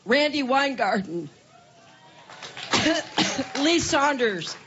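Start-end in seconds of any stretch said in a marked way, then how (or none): 2.4-3.6 hard dry cough; chesty cough. Real, not acted. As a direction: cough between names. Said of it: "she had quite a coughing fit".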